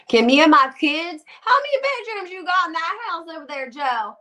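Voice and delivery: mock Southern woman pleading in a high pitch; Southern accent. Imagined houseguest begging Joe for a room, hammed-up Southern accent. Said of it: "that's my southern accent".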